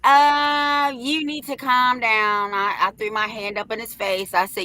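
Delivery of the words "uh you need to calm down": nervous, slow, wobbly mock plea; mocking principal. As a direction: imitate a scared man begging her to calm down. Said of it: "here comes the first principal".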